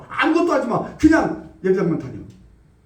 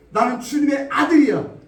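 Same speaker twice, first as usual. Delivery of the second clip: 아들이야 cried out high and loud; shouted declaration; 5 semitones higher, 4 dB louder than usual. Acted. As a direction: proclaim 아들이야 boldly. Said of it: "믿음의 말을 하라는 거예요". (아들이야를 힘차게 외치는 믿음의 선포)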